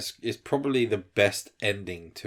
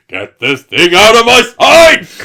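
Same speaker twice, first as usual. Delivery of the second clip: loud, high, slow furious yell; Hugh Laurie shout; 10 semitones higher, 17 dB louder, slower than usual. Retold or imitated. Doctor bellowing at a colleague, loud slow shout. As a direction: bellow it like a doctor losing his temper. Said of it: "and he goes".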